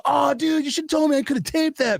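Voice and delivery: high-pitched mock dismay; excited protest. Friend who missed taping his radio debut, high excited complaint. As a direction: whine to a buddy, voice way up.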